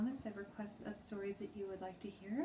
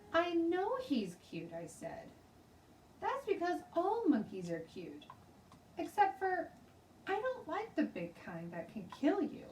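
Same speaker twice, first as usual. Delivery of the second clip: put-on high, eager little girl insisting; childish protest, pitched up; a young girl sure she is right; 11 semitones higher, 4 dB louder than usual.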